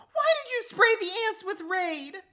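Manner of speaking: high loud demanding question; scolding mom. Acted. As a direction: mom yelling at her brother, high and loud.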